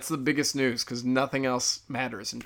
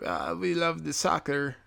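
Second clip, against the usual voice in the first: slow, higher mock Mexican accent; horrible Mexican accent; 5 semitones higher than usual. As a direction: badly fake a Mexican accent, drawn out. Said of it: "That's a horrible Mexican accent".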